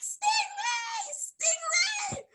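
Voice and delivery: high shouted stingray, twice; panicked screaming. Retold or imitated. Kids running from the shadow in the water, high panicked shouting. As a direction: scream it while fleeing through water. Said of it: "we're screaming, and we're like".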